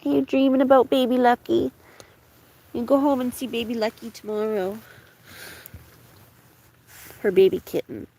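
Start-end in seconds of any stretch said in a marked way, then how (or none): 0.0-4.8 high, louder sing-song coo to a pet. Real, not acted. Cooing at her waking dog about its kitten, high baby talk.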